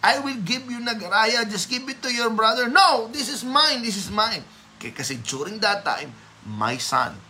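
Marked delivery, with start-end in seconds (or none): 0.0-2.6 high, coaxing imitation of a grandfather; lightly acted bribe, pitched way up; bribing grandfather. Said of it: "And then there was this bribe". 2.6-4.4 mock-child protest, pitched way up. Acted child refusing grandfather's bribe.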